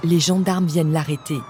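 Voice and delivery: usual speaking voice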